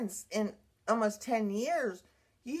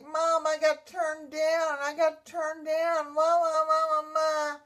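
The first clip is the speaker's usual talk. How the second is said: tearful wail, re-enacted; 6 semitones higher, 3 dB louder than usual. Daughter phoning mom after being turned down, re-enacted tearful wail. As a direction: imitate wailing to mom like a distraught child. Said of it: "and I was in tears".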